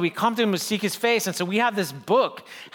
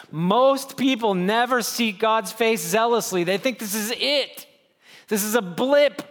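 normal talking voice

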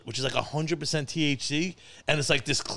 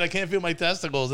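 ordinary talk

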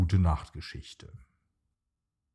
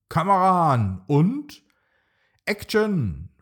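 higher, louder gleeful call; playing director with delight; cheerful shout; 8 semitones higher, 5 dB louder than usual. A narrator's reading. Little Bronto starts the video for Mama, calling out gleefully. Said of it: "ruft Bronto vergnügt".

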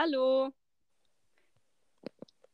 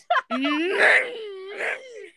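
high squealing laugh; 8 semitones higher, 5 dB louder than usual. Real, not acted. Laughing at motor noise.

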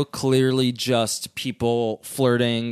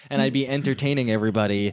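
usual speaking voice

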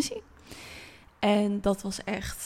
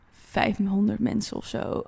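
ordinary talk, nothing marked

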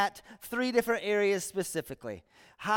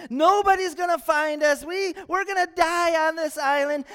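higher and louder, frantic castaway; panicked shout; 8 semitones higher, 5 dB louder than usual. Acted. Shipwrecked man panicking to his buddy, frantic shouting. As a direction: yell it like the sky is falling. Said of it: "And he's freaking out".

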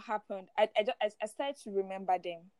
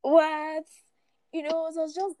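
surprised; 7 semitones higher, 5 dB louder than usual. Retold or imitated. Repeats her stunned reaction.

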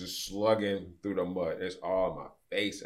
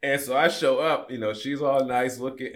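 still laughing; 6 semitones higher, 8 dB louder than usual. Real, not acted. Laughing while telling prom arrival.

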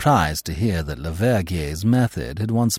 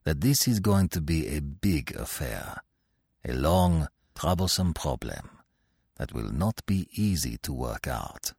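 grave; 7 semitones lower, 9 dB quieter than usual. Acted. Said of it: "he said slowly".

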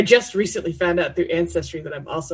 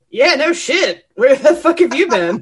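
mocking, higher, louder, with a laugh; sarcastic "no shit"; 7 semitones higher, 4 dB louder than usual. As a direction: scoff at the obvious, half laughing. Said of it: "who's seen it enough times is like".

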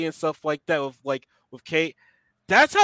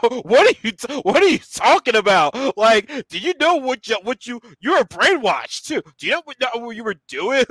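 higher, louder incredulous challenge; mock indignation; 8 semitones higher, 4 dB louder than usual. Viewer telling off Yelena, high and indignant. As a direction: confront her in exasperated disbelief.

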